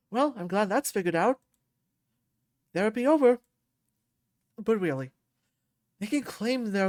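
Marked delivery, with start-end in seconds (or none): 2.7-3.4 playful. Acted. Joking after laugh.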